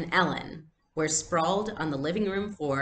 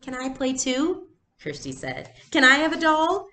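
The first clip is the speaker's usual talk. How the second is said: much higher, louder pleading; eager child; 9 semitones higher, 6 dB louder than usual. Acted. Little girl to the older girls, eager to join.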